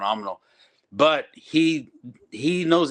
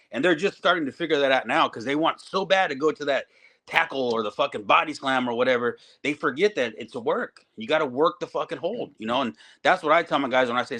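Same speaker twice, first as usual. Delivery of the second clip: normal talking voice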